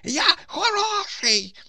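very high, loud, drawn-out pleading yell; репетування. A narrator's reading. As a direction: shriek it slowly at full force. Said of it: "репетував, що було сили". (протяжний високий крик щосили)

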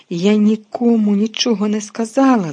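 loud, high shriek of denial. A narrator's reading. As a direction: screech it, desperate to be believed. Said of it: "заверещала Герміона".

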